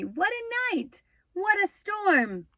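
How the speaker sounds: high twittering bird voice; twittering wren. Acted. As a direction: chirp it like a little wren. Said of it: "twitters wren".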